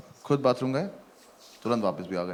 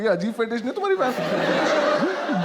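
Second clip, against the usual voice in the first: laughing; 8 semitones higher, slower than usual. Retold or imitated. Mocking question, laughing.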